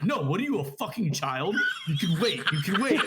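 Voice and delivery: acted irritated refusal; mock exasperated scolding. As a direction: scold a friend, building on the repeat. Retold scolding in car quarrel.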